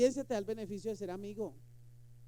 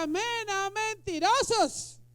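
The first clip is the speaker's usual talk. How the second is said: mock God calling liars; 8 semitones higher, 3 dB louder, slower than usual. Dios imitado llamando mentirosos.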